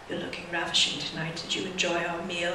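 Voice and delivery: low, quiet, suave seducer; soft low spy seduction act; James Bond. Acted. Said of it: "James Bond would come in".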